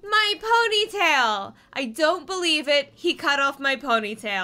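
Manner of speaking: hammed-up grief; melodramatic lament. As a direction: wail in disbelief. Victim wails over cut hair.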